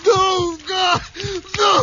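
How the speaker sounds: high-pitched, broken wails; agonized cries. Acted. Bob crying out as his chest is torn, agonized. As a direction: cry out in terror between gasps.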